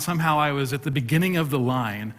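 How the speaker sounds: ordinary delivery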